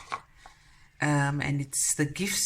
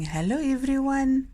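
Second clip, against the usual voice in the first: higher, louder, silly dog voice; voicing the dog; 10 semitones higher, 5 dB louder than usual. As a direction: pretend the dog speaks. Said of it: "He's saying".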